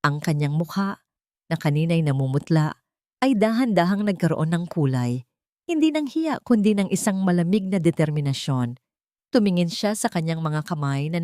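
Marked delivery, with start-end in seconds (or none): none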